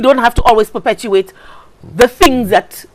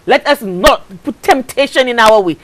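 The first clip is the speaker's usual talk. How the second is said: ordinary talk, nothing marked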